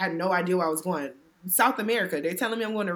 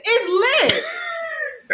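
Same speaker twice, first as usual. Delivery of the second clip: high, hammed-up young guy voice; high mock-hyped "It's lit"; 5 dB louder than usual. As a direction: play the hyped young local.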